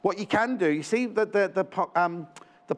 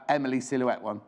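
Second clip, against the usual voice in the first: ordinary delivery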